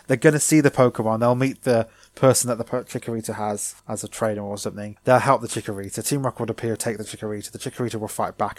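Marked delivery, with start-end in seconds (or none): none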